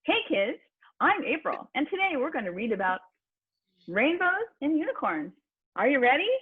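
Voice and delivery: put-on high, bright voice reading to kids; library story time. Shown as a demonstration. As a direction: lift it up like reading to children at the library. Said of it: "story reading time at the library for kids".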